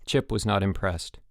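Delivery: plain reading voice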